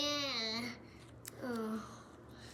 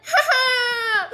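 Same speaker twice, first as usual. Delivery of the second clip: high, loud "ha ha" burst; triumphant cackle; 11 semitones higher, 11 dB louder than usual. Pretend. Player gloats over killing all humans, triumphant loud cackle. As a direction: cackle loudly like a victor.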